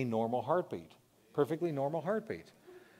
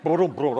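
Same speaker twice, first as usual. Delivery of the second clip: loud booms after a pause, half laughing; the heart kicking back in with a jolt; loud, laughing heartbeat restart; sudden heartbeat booms; 7 dB louder than usual. Shown as a demonstration. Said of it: "Then nothing".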